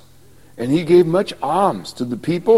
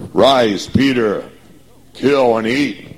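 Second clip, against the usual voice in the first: heavenly command; 3 semitones lower, 4 dB louder, slower than usual. Acted.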